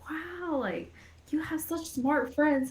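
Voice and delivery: high-pitched imitation of parental admiration; impressed parents. Voicing her impressed parents.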